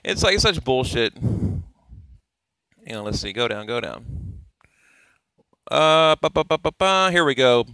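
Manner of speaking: normal talking voice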